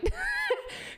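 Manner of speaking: squealing high laugh. Real, not acted. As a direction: shriek of laughter. After mock concession.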